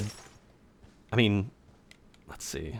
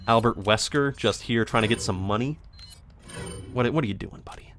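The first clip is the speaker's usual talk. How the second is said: ordinary delivery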